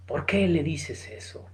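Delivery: slow, very soft reproach; quiet, unhurried acted reproof; hushed rebuke. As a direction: drop almost to a whisper for the retold question. Thief's rebuke to companion, voiced.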